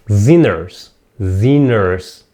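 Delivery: "Sinners" drawn out with no g sound; silent g. Shown as a demonstration. Teacher removing the G for students, silent g. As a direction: say "Sinners" low, only n in the middle. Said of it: "لا ننطق ال G".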